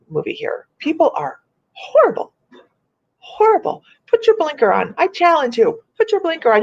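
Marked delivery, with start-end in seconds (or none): 0.8-3.8 venting at rude drivers, pitch climbing; exasperated rant. Real, not acted. Complaining to viewers about local drivers, exasperated high cry. 4.1-6.6 mock scolding drivers. Venting to viewers at drivers who won't signal, high mock scolding.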